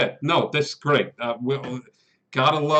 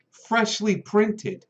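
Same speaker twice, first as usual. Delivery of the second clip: higher and slowed right down; slow mock boast; 8 semitones higher, much slower than usual. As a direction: savor each word like a boast. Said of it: "he looks at it and goes".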